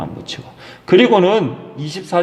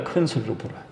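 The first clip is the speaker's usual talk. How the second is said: 큰 said low and much softer, almost an aside; soft echo; 6 semitones lower, 11 dB quieter, faster than usual. Shown as a demonstration. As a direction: drop to a hush on 큰 소리로.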